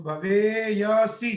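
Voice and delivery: drawn out, syllable by syllable; slow spelled-out form. Shown as a demonstration. Teacher building the form for students, slowly drawn out. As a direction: lay out भवेयसी slowly so students follow. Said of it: "य का लोप कर दीजिए".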